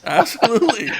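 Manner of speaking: laughing. Real, not acted. Co-host laughing at bar joke.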